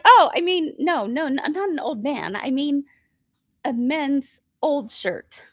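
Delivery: put-on hastig korrigiert, Betonung auf „old“ in „a men's old shirt“; stammering back-pedal, stress landing on „old“; flustered correction. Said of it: "I'm like".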